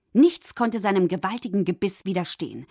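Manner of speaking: ordinary delivery, nothing marked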